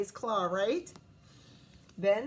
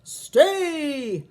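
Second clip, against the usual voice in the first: loud roared command; booming dog trainer's roar; 4 semitones higher, 10 dB louder than usual. Acted. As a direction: roar the order at runaway dogs. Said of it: "roared the leader".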